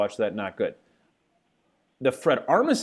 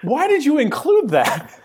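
loud, high, drawn-out disbelief; exasperated question, pitched way up; incredulous; 6 dB louder, slower than usual. Real, not acted. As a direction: demand an explanation in amazement. Host baffled by the edit.

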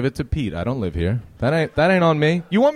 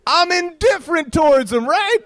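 high laughing call; 14 semitones higher, 4 dB louder than usual. Acted. Crowd-work, laughing.

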